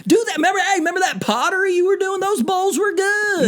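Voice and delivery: very high hammed-up Southern accent; squeaky-high Southern coach, egging him on; Rhule, Southern accent. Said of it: "Rhule talking in a Southern accent".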